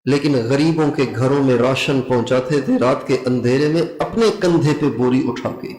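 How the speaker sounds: normal talking voice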